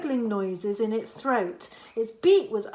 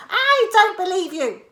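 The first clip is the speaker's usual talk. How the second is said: high, loud granny voice; high loud old woman, scoffing; Grandma's voice; 9 semitones higher, 8 dB louder than usual. A narrator's reading. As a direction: call back as Grandma. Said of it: "she answered".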